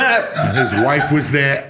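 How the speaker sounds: laughing through the words, slow; words breaking into laughter. Real, not acted. Laughing mid-rant about the wake.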